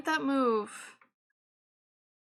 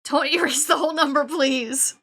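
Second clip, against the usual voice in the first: laughing plea; 8 dB louder than usual. Real, not acted. Pleading with the software.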